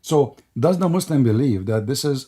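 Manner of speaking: normal talking voice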